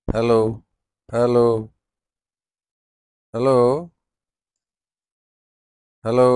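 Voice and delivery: loud, repeated mic-check hellos; loud hello into a bad line; calling hello. Real, not acted. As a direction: call out to see if anyone hears.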